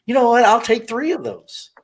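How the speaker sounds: high, hammed-up eager yes; mock eager buyer. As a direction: sold instantly, hammed up. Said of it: "I'm like".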